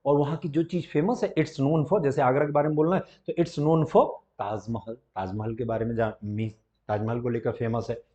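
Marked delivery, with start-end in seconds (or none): none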